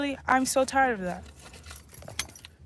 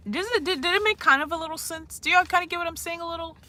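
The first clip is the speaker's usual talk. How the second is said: usual speaking voice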